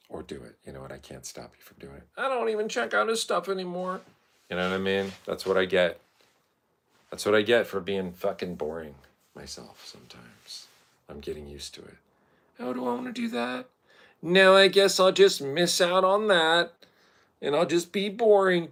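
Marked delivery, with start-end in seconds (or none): 2.2-4.0 very high mocking voice; high put-on dismissal; dismissive viewer voice. Voices a bored former viewer. 12.6-18.7 high, sarcastic self-mockery; mock yourself in a high voice, growing loud; mocking put-on voice. Mocks himself with put-on voice.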